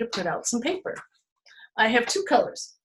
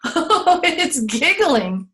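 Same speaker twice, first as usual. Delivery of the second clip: mock excited; 3 semitones higher, 6 dB louder, slower than usual. Playful pumpkin voice.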